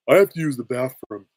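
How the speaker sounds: loud cartoon dimwit; Patrick. Cartoon starfish telling the whole bar, loud impression. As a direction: announce it loudly in a cartoon voice. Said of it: "and he says".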